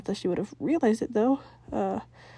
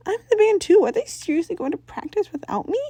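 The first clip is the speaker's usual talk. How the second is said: high, loud, indignant disbelief; indignant outburst; 10 semitones higher, 6 dB louder than usual. Acted. Sam protests to Tucker, high and indignant. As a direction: Sam cries out, offended to be left out. Sam realizes she's left out.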